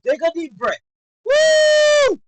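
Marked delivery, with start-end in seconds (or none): 1.2-2.2 high, loud sustained "woo"; long thrilled whoop. Real, not acted. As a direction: whoop at full voice like a fan. Cheering after the round.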